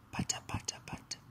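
soft unvoiced heartbeat sounds; whispered heartbeat. A narrator's reading. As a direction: breathe the tiny pulse in a whisper. Imitating heartbeat.

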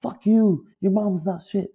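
high, jeering fan shout; imitating heckling fan. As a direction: taunt him from the stands. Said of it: "they call me [name]".

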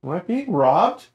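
lightly played alarm on waking; mildly alarmed. Woken man to the people who seized him, mildly alarmed.

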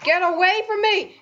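yelling in panic; panicked yell. Real, not acted. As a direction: shout it, scared and urgent. Panicked yelling at game enemy.

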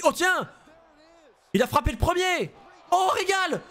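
shocked shout at the surprise punch; excited shout. Real, not acted. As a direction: yell it out in shock at the ring. Reacting to a surprise attack.

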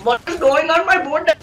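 put-on joking Indian accent. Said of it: "it's really fun talking in Indian accent".

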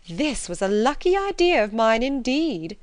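high, loud, gloating mother; delighted self-congratulation; self-satisfied mother. A narrator's reading. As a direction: crow over your clever idea. Mother congratulates herself on scheme.

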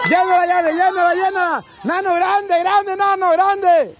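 high, rapid-fire repeated commands; urgent cheering on. Real, not acted. Host urges players to fill the container, excited chant. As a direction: urge them on in a rising frenzy.

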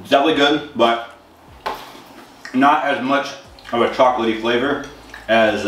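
speaking between spoonfuls; talking while chewing. Real, not acted. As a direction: mouth partly full of cereal. Seen 5: spoon to mouth around 6.4, chewing jaw visible throughout.

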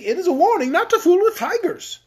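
high, loud declaration; comic king, spirited. Acted. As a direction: deliver the moral as a loud warning. Voices Rinkitink's reply.

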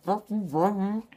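barely intelligible through a full mouth; cheeks stuffed full. Real, not acted. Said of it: "I don't understand you anymore".

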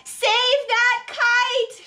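high, loud, slow shout. A narrator's reading. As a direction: yell urgently to save it. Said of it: "shouted Mole".

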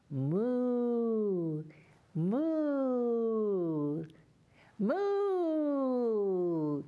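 put-on a gentle cow moo for children. Shown as a demonstration. Teacher models a moo for the children. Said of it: "Can you moo?".

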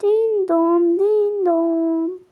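sing-song din don like a doorbell chime; doorbell imitation. Announcing a visitor with a voiced doorbell chime.

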